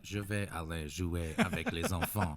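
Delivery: mock a learner's bad French accent; clumsy accented schoolboy French, played for laughs; horrible French accent. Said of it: "in a horrible accent".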